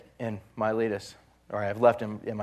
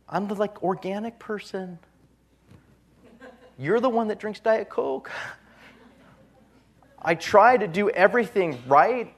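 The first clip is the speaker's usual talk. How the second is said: complaining believer; 7 semitones higher than usual. Retold or imitated. Voicing complaining sick believer.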